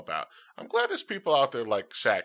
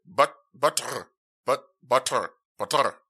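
Scottish accent; 5 dB louder than usual. Shown as a demonstration. Host practises Scottish butter, mock Scottish accent. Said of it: "So you say".

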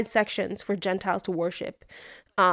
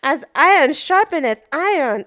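mock preacher voice; 7 semitones higher, 7 dB louder than usual. Quoting scripture mockingly.

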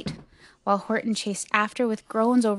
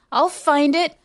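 loud, high, determined; raised, determined cry; 6 semitones higher, 5 dB louder than usual. A narrator's reading. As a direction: call it out with resolve. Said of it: "cried Horton".

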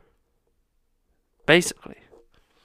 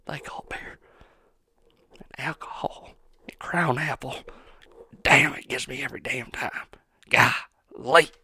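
hushed, half-joking whisper; mock whisper; 3 semitones lower than usual. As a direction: breathe it like a secret confession. Drunken musing whisper.

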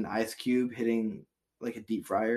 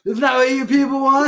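mock outraged shout; 12 semitones higher, 12 dB louder than usual.

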